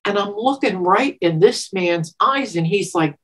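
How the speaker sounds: ordinary talk, nothing marked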